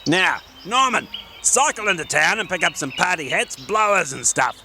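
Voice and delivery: high, bossy little gnome; cheerful small gnome with a plan; Gordon the gnome. Acted. Gordon gives orders.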